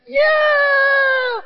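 high, loud joyful yell; thrilled scream. Retold or imitated. Girl cheering as the coaster starts. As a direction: scream with delight, hands up.

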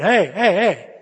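loud, repeated attention-getter; calling to sheep. Acted. Shepherd knocking a wandering sheep, loud calling. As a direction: call out in sharp bursts.

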